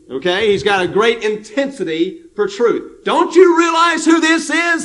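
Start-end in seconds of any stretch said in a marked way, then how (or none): 3.0-4.8 high, louder cry of disbelief; a fiery disciple, outraged that the Lord is refused; intense indignation. Retold or imitated. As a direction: son of thunder demanding to know if they see who this is. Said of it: "is kind of what he's saying".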